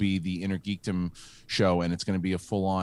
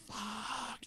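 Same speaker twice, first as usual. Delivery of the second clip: high, hushed, drawn-out swear; deflated curse; 13 semitones higher, 14 dB quieter than usual. Acted. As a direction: let it leak out in soft, high despair. Deflated inner reaction to losing Santa.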